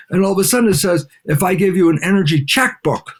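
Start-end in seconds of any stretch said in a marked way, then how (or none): none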